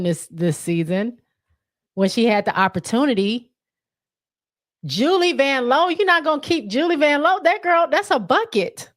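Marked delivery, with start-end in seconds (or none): 4.8-8.9 high-pitched, animated disbelief; real outraged disbelief, pitched way up; incredulous protest. Real, not acted. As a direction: protest as if it's unthinkable. Disbelief at player left unprotected.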